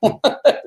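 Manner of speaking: short high-pitched laugh. Real, not acted. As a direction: laugh at your own story. Laughs at his own horrible story.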